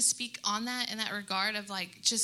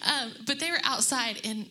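normal talking voice

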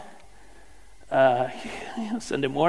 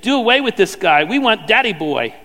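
mob shout; 3 semitones higher, 6 dB louder than usual. A narrator's reading. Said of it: "Howling like a mob".

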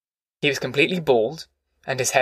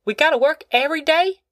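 put-on young boy; 13 semitones higher than usual. A narrator's reading. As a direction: ask it like a worn-out little boy. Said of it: "Winfield asked".

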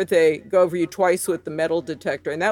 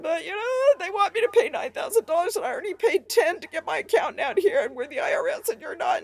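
high, whiny retold blubbering; 10 semitones higher than usual. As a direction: plead like your tearful younger self on the phone. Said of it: "Stop blubbering".

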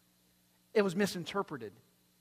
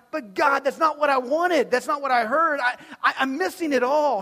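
high, loud, anguished protest; pleading with God; 8 semitones higher, 13 dB louder than usual. Retold or imitated. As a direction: cry out that it isn't what you wanted.

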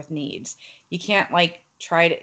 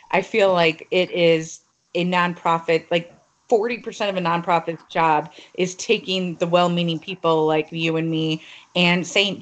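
normal talking voice

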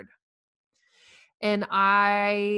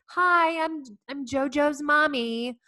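bright, much higher, louder cheerful mom greeting; chirpy mom; 7 semitones higher, 3 dB louder, slower than usual. Retold or imitated. Cafeteria mom introducing herself to others, chirpy. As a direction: chirp it like a perky school mom. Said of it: "other women ... are like".

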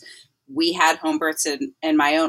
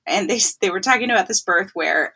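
ordinary talk, nothing marked

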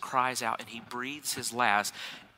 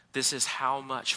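ordinary delivery

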